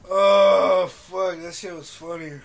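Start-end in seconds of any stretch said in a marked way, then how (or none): none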